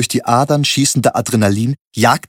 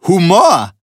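loud, high mocking bark; 1 taunt; 8 semitones higher, 6 dB louder than usual. A narrator's reading. Thug taunts the narrator with a loud bark. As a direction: spit the word like an insult.

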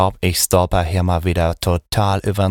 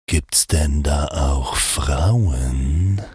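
slow deep macho voice; 5 semitones lower, much slower than usual. Acted.

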